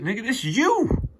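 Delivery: ordinary talk, nothing marked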